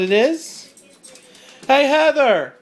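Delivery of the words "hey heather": louder, higher call across the house. Real, not acted. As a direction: call out to the wife in another room. Calling out to wife.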